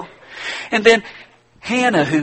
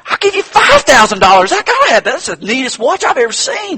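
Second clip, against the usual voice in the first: high, loud, pleading buyer; desperate eagerness; 11 semitones higher, 6 dB louder than usual. Acted. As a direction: push the offer, you just have to have it. Buyer desperately raises offer.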